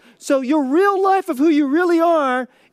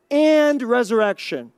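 long, emphatic "and" before the noun; stress on "and". Shown as a demonstration. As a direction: make "and" the strongest word. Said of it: "I wanna put emphasis on the word and".

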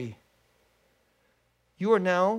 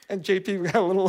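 ordinary talk

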